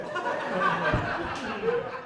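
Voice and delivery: laughing. Real, not acted. Laughing after joke.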